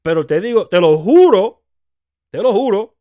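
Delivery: loud, insistent te lo juro; emphatic oath. Real, not acted. Host swearing to listeners about husbands, loud emphatic oath. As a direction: swear it loudly in Spanish, leaning on juro.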